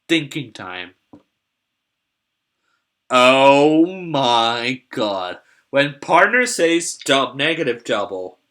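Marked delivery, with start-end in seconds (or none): 3.1-5.4 drawn-out oh my god; slow awed. Real, not acted. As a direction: stretch it out in awe. Drawn-out awe at bid.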